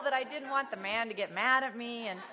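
ordinary talk, nothing marked

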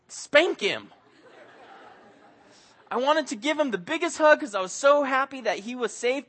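ordinary talk

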